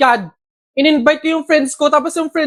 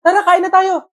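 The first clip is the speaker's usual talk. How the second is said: ordinary talk, nothing marked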